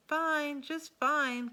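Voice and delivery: bright eager reply. Acted. Koala answering her mother, cheerfully. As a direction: chirp it out eagerly.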